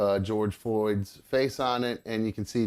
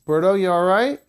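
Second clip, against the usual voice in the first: higher, louder call across the house; worried call to a pet in another room; calling to the cat; 7 semitones higher, 7 dB louder than usual. Real, not acted. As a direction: call out to check on him.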